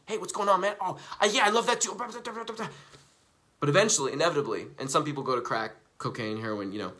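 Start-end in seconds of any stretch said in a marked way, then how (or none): 0.1-2.0 high-pitched eager party chatter; mock buzzed socializing. Smoker on a high socializes with people, mock eager chatter. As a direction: gush along with everyone, hammed-up.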